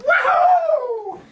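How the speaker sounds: high, loud joyful shout; triumphant whoop. Retold or imitated. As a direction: whoop over the trap again.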